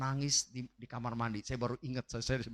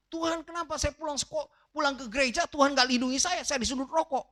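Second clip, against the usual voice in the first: high-pitched re-enacted wail of protest; angry tearful complaint; 11 semitones higher than usual. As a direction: plead with God like an upset child. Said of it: "saya marah sama Tuhan. Nangis saya".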